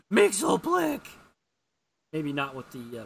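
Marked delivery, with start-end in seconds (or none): 0.1-1.1 high, loud scream with a pubescent crack; mock scream, voice jumping up; Superman. Superman screaming a villain's name, cracking high. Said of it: "then Superman screams".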